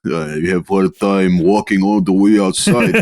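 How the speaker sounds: put-on lightly done Russian accent, dropped articles. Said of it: "wake up with a Russian accent".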